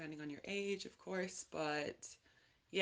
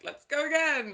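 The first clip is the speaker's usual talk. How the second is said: mock hungover cheer; 10 semitones higher, 7 dB louder than usual. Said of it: "waking up hungover and just, like".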